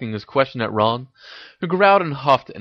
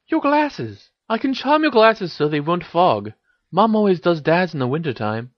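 put-on higher-pitched girl character voice; Ginny, helpful; 8 semitones higher than usual. A narrator's reading. Ginny explains a glasses charm to Harry, helpful and higher.

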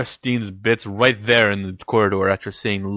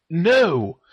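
firm, raised-pitch refusal; forceful; 8 semitones higher than usual. A narrator's reading. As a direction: shut it down hard. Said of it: "he answered forcefully".

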